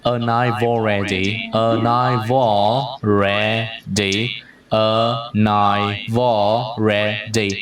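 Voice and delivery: "a knife already" slowly, V carried over: "a-nai-vall-ready"; linked V. Shown as a demonstration. Teacher demonstrating linking, "knife already" joined through V. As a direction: join "knife" to "already" with a V, syllables drawn out. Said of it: "Nối âm V qua đây luôn".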